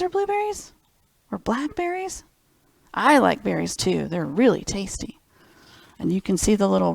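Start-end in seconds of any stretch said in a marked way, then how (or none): none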